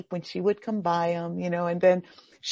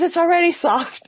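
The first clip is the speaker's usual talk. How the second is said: put-on high, louder child reply; little girl voice; 9 semitones higher, 6 dB louder than usual. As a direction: answer like a cheeky four-year-old. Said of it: "She goes".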